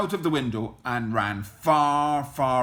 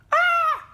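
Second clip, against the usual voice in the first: short high-pitched scream; loud, very high startled shriek; granny's yell; 9 dB louder than usual. A narrator's reading. Granny seeing the wolf at her door, shrieking yell. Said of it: "yelled Granny".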